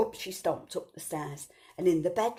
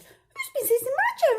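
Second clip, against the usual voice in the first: much higher, louder alarmed mother; Mummy Bear; 7 dB louder than usual. Acted. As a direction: shriek it in high alarm. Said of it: "Mummy Bear said".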